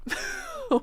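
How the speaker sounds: short high giggle; amused little laugh. Real, not acted. Laughs at own point.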